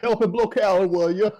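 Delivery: high mock cockney plea; mock British. Player begging guards for help, mock cockney. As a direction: ask for help like a London bloke. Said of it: "I can communicate".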